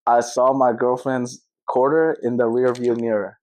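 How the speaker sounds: American accent, slow and careful. Shown as a demonstration. Host repeats the tongue twister in his American accent. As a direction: say it the American way, slowly. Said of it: "You say it in yours".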